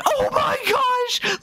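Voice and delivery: high-pitched, drawn-out cry of amazement; amazed scream. Real, not acted. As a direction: squeal it as the launch kicks in. Launch control sends car off.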